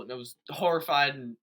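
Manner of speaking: ordinary talk, nothing marked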